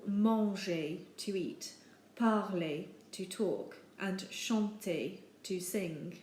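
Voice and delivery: ordinary talk, nothing marked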